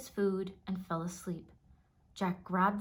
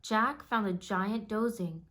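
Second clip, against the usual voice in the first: usual speaking voice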